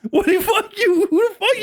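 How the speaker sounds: high, laughing mock yell at him; half-laughing confrontation; laughing mock outrage.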